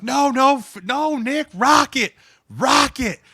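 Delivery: high-pitched mock yell; imitated shouting match, loud and insistent; Phil. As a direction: overrule a teammate at full volume. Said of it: "they're just yelling at each other, dude, like, screaming".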